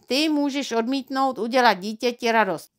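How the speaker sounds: usual speaking voice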